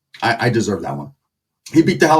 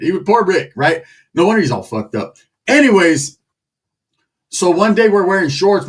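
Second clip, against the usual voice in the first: ordinary talk, nothing marked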